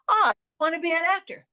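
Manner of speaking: high, fast, loud whining youngster; a pleading kid who won't listen; whiny kid voice. Retold or imitated. Kid insisting on acting.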